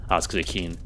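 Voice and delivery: usual speaking voice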